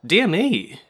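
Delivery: exclaimed. A narrator's reading. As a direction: burst out in delighted surprise. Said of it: "she exclaimed".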